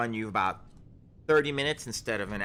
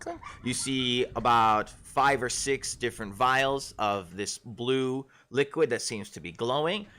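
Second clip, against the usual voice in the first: usual speaking voice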